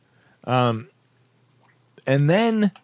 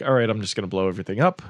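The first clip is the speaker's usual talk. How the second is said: rushed; 2 semitones lower, much faster than usual. Retold or imitated. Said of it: "Then he's like".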